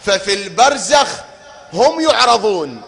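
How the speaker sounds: fiery sermon climax; rising preaching shout. Real, not acted.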